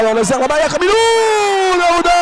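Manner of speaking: high excited goal call; excited sports commentary. Real, not acted. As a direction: build up and celebrate.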